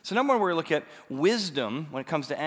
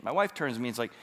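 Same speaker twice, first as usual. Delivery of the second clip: usual speaking voice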